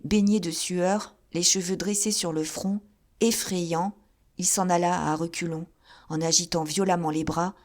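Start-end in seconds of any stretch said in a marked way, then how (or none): none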